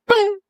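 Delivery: squeaky high bing, a mock chirp. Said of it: "She comes over and goes".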